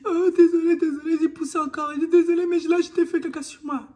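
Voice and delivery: high, loud tearful sorry; crying apology. Retold or imitated. Mother in labor apologizing to midwife, crying. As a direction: apologize in tears, mortified. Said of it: "en pleurant".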